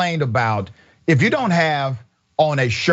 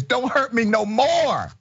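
mocking Karen; 8 semitones higher, slower than usual. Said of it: "And then Karen says".

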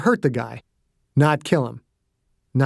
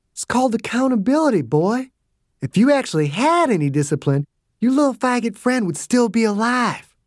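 higher, sneering tough-guy goading; cocky taunting old soldier; 8 semitones higher than usual. Acted. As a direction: dig the knife in, mocking him. Dew goads Perry.

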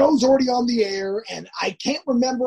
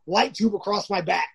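ordinary talk, nothing marked

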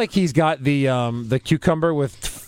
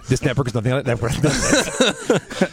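laughing; 4 semitones lower, slower than usual. Pretend. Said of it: "put a disclaimer".

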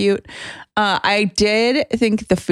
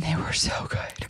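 whisper; 11 dB quieter than usual. Real, not acted. Whispered aside about strong drinks.

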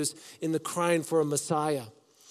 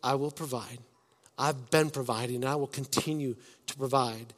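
calm reassurance; 5 semitones lower, 6 dB quieter than usual. Retold or imitated. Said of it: "God's like".

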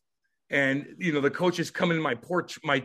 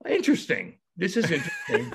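imitated intrigued coaches; 10 semitones higher than usual. Coaches finding things in player's truck, mock intrigue. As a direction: poke around with put-on interest. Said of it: "they're like".

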